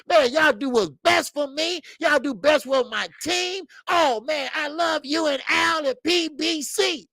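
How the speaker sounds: put-on high, giddy voice of a grateful young fighter; high-pitched, lovestruck fighter thanking his boss; gushing fighter. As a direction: play the star-struck fighter gushing over his promoter. Gervonta voiced gushing over Floyd.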